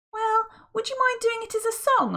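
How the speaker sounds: very high, hopeful request; Friday, eager. Acted. Friday asking Mister Gum to sing, very high. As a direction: beg sweetly for a song.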